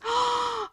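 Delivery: sharp high gasp; dismayed gasp. Acted. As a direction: gasp at the closed door. Shop is closed.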